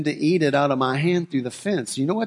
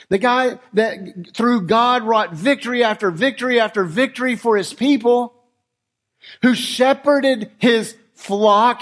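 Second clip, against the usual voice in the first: rising preaching; 5 semitones higher, 3 dB louder than usual. Acted. Preacher stirring the congregation about King David, building fervour.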